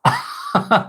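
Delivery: high, airy burst of laughter; real giggle at own joke. Real, not acted. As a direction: let out a short high-pitched laugh.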